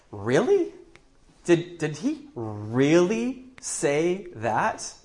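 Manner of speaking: slow, drawn-out sarcastic questioning; sneering doubt, as if it's absurd; mocking disbelief. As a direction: stretch it out with rising, condescending incredulity. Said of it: "a mocking tone of voice".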